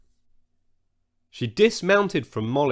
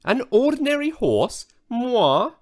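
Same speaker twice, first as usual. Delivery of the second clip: much higher, offended squeal; indignant squeal; 11 semitones higher than usual. Acted. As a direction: squeal it, deeply insulted. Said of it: "the unicorn squealed indignantly".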